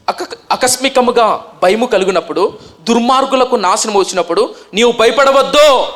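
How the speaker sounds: a loud, slow Bible reading to the congregation; solemn scripture reading. Acted. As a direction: read the verse out as a proclamation, slow. Reads scripture verse aloud.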